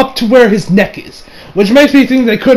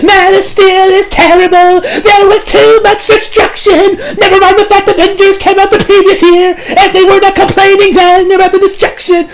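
mock the critics in a whiny voice; mocking other fans; 12 semitones higher, 4 dB louder than usual. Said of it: "people are gonna come out here and be like".

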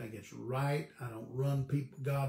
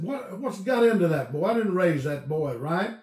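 louder, higher, indignant outburst; a father fuming at his son's rude letter; how dare that boy, said with mock outrage; outraged father; 5 semitones higher, 11 dB louder than usual.

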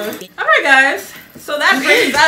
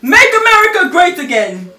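louder, higher campaign slogan; Trump; 5 semitones higher, 5 dB louder than usual. Acted. Player shouting a slogan to be guessed, politician impression. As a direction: proclaim it like a rally speaker.

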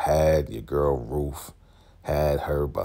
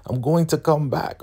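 very high mock-sweet voice; promising sweetly, insincere; fake sweet; 12 semitones higher than usual. Marva promising to return, high fake sweet. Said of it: "She's like".

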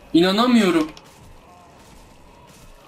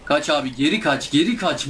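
ordinary talk

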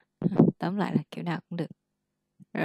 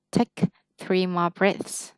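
slow model phrase; much slower than usual. Shown as a demonstration. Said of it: "mình có thể nói take three more".

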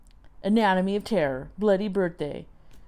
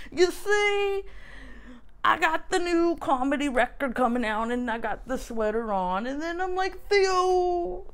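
Bill Cosby impression; 9 semitones higher than usual. Warned viewers it's worse, does bad Cosby anyway. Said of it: "my Bill Cosby".

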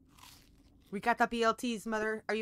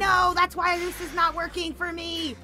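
louder, higher cheering; excited loud cheer; 7 semitones higher, 6 dB louder than usual. Real, not acted. Excited shout to her partner about blocking. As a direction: celebrate the block loudly.

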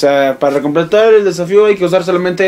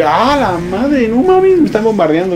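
high drawn-out cry climbing higher; alarmed outcry; 6 semitones higher than usual. Real, not acted. Gamer suddenly bombarded, alarmed outcry. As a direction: exclaim in rising shock.

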